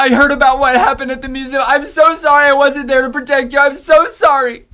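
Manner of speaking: high, loud, gushing apology; distraught mom apologizing. Acted. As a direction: pour out guilt as a frantic mother. Worried mother apologizing to daughter.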